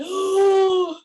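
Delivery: high, loud gasped "Oh" sucking in air; a scream and a gasp at once; startled gasp. Retold or imitated. Surprised driver's-seat stranger gets a gasped oh. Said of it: "combination of a scream and a, and a sucking in air".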